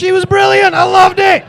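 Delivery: high, very loud burst of delight; ecstatic shout. Acted. Comedian thrilled at being abused for being English, ecstatic shout. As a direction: yell it with over-the-top joy.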